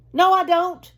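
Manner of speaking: a small voice refusing in panic; shriek. A narrator's reading. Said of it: "shrieked the voice".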